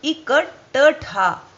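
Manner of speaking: इकट्ठा said slowly with a half ट, ट्ठ; इकट्ठा spelled out slowly, half ट then ठ; slow careful इकट्ठा; correct pronunciation. Shown as a demonstration. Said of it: "बीच में आधा ट है".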